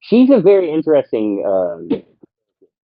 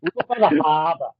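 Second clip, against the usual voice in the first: put-on tossed-off Boston accent parody; mock Boston accent; 2 semitones higher, 4 dB quieter than usual. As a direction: play up the Boston accent for laughs. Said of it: "with that accent".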